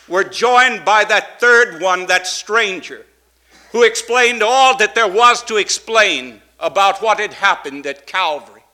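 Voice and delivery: loud, rising preacher's cadence; fervent pulpit preaching. Acted. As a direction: preach it out to the congregation. Preaching at full force.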